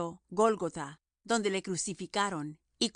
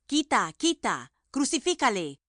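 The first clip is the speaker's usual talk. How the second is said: crowd shouting; 4 semitones higher, 5 dB louder than usual. A narrator's reading. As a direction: drag out quita, quita, then shout crucifícale. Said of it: "Mas ellos dieron voces".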